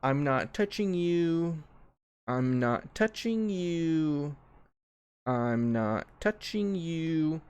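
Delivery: slow, drawn-out teasing taunt; slow mock-innocent taunt; taunting sing-song. Shown as a demonstration. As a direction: needle a sibling, stretching each word. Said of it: "we have the".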